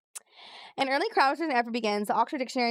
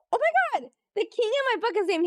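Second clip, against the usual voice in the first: squealing, much higher, louder oh my God; real thrilled surprise at a coincidence; delighted shock; 11 semitones higher, 3 dB louder than usual. Real, not acted. Recognizes her book's king name.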